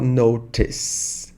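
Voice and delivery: British "notice" with a true T; British accent. Shown as a demonstration. Teacher showing how the actress says it, British "notice". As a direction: sound the T fully in "notice", British-style. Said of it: "por ser britânica, ela vai falar".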